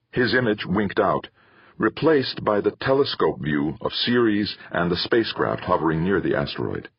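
plain reading voice

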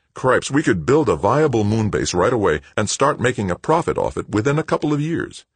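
high, loud, swooping enthusiasm; excited. A narrator's reading. Paul picturing a profitable moon base, excited. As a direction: get carried away by the possibility. Paul carried away by the plan.

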